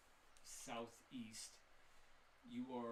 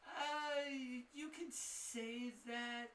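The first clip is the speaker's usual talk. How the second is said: evasive shopkeeper; 10 semitones higher, 4 dB louder than usual. Acted. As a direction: dodge the question, then hint at ghosts.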